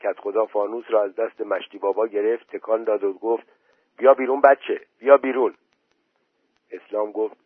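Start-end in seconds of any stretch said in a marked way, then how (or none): 4.0-5.5 louder, higher urging call. A narrator's reading. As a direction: summon the child insistently. Headman calls boy out again.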